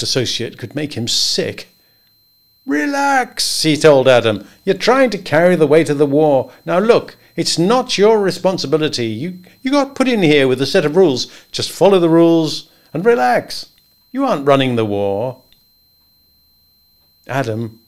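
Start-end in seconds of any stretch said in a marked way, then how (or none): 2.7-4.5 high, bluff reassurance; high cheerful townsman; hearty Henry. Acted. Henry soothing Adam. 4.6-15.4 higher, louder, bluff and jovial; higher voiced good old boy; hearty Henry. A narrator's reading. Henry lecturing Adam kindly.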